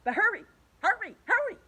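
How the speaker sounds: high, loud, fast urging; urgent hurry. A narrator's reading. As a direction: rush him along. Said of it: "said Centipede".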